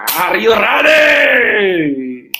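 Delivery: long drawn-out hype-man call; big, slow rallying holler; rallying shout. Real, not acted. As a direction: stretch it out and rally the crowd. Rallying the seminar audience.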